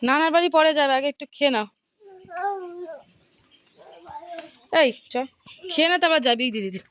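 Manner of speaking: coaxing call. Real, not acted.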